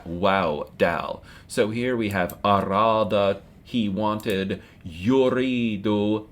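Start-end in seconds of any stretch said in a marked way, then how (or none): 4.9-6.3 yuridu drawn out slowly, every syllable clear; slow Arabic model. Shown as a demonstration. Teacher showing students yuridu, slow careful Arabic. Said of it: "he wants".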